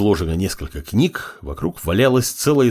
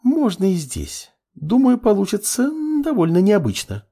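high, teasing female voice with a sly smile; 13 semitones higher than usual. A narrator's reading. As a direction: muse slyly, high-pitched and unhurried. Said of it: "хитро улыбнулась Левитания".

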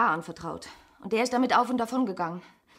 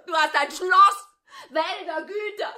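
crying; 6 semitones higher, 4 dB louder than usual. Acted. Accusing through tears.

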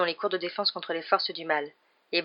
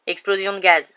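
terse grumbled answer, fairly loud; grumbling; 6 dB louder than usual. A narrator's reading. Driver answers Stan's question, grumbling. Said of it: "grommela Ernie".

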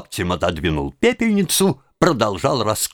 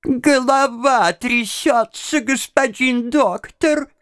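put-on high, slow, mumbling old lady; mumbling old woman; 12 semitones higher, slower than usual. Old woman tells the doctor her symptom, high and mumbling. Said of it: "шамкая и трясясь, изобразил старушку".

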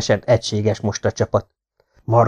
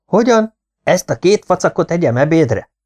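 indignant surprise; 7 semitones higher than usual. Acted. Ali outraged at the pills.

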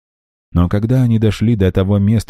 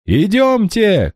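much higher, slow call; urgent shout; slower than usual. A narrator's reading. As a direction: shout to move on. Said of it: "кричал Мармузе". (громкий призыв)

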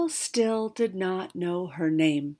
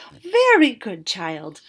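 louder, brighter praise from the dragon; enthusing; 2 semitones higher, 9 dB louder, slower than usual. Acted. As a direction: cheer the child on. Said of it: "the dragon enthused".

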